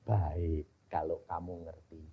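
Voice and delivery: low and soft, gentle; gentle Lord's answer. Retold or imitated. Preacher voicing the Lord's gentle reply, low and soft. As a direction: answer softly, kindly. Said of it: "Tuhan jawabnya apa".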